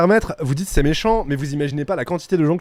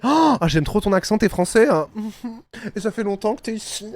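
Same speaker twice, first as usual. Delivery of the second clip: imitated admirer; 5 semitones higher than usual. Imitating people who love his French accent, gushing. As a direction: coo over someone's accent, lightly hammed-up. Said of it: "on m'a toujours dit".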